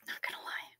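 confiding whisper, barely voiced; sneaky whispered admission; whispered aside. Real, not acted. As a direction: let it slip out as a secret. Aside while laughing about mom.

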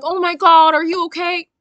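high, loud fussing gasp of concern; mock concern. Guys checking on a crying contestant, imitated with mock concern.

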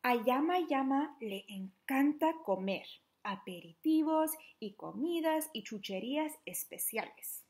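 ordinary delivery

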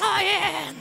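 high slow puppet voice. Acted. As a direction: stretch it out in the wolf puppet voice. Puppet wolf speaks.

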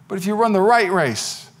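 normal talking voice

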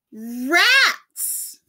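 high loud squawked cry; a hen's shrill warning cry. A narrator's reading. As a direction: squawk it out in alarm. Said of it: "squawked a hen".

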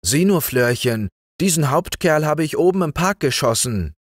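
high, eager lad presenting his prize; proud announcement. A narrator's reading. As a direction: show off your catch proudly. Lad proudly shows his shot hare.

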